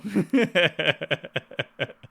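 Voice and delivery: loud, high burst of laughter; big laugh. Real, not acted. Laughs after outburst.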